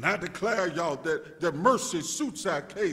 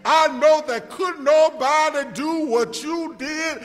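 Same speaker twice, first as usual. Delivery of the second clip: louder, rising preacher's call toward a shout; full-force preaching; 6 semitones higher, 11 dB louder than usual. Acted. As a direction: build it up like a Sunday climax.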